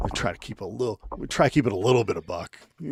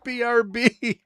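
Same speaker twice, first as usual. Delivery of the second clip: higher, breezy mock dad saying BRB; 3 dB louder, much slower than usual. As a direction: toss off a casual goodbye to the kid. Joking dad leaving his kid.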